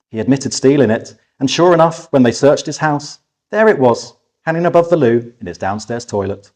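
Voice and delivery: ordinary delivery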